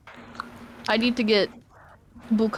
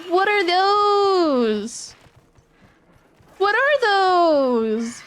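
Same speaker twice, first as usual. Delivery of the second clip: drawn-out exclaim; 8 semitones higher, 3 dB louder, much slower than usual. Real, not acted. Drawn-out exclaim at odd creatures.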